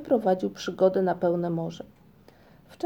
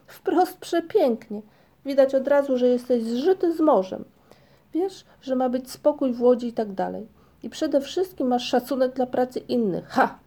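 mocking; 5 semitones higher, 5 dB louder than usual. Migotek scoffing at Ryjek's tangled rope, loud sarcastic mockery. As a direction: heap scorn on the clumsy helper, pitched up and loud. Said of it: "zawołał kpiąco Migotek".

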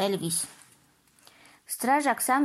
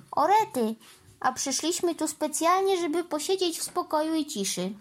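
higher-pitched self-directed grumble; grumbling to himself; 6 semitones higher than usual. A narrator's reading. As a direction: complain to yourself, pitched up. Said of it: "mruknął Trevor sam do siebie".

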